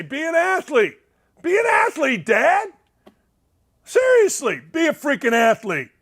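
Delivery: high, pleading caddy exhortation; exasperated caddy. Retold or imitated. Host quoting a golf caddy to the dad, exasperated. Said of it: "What did his caddy say?".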